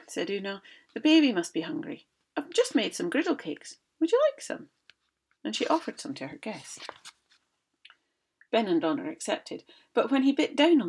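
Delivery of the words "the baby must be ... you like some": high, loud mock-worried wife; hammed-up motherly fuss; Una's voice. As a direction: fake concern for the baby. Una voiced in story.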